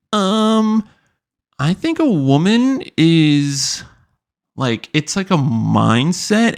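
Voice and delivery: high, slow airhead voice; ditzy girl. Retold or imitated. Mocking a clueless guest's slow, high answer. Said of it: "but a bimbo".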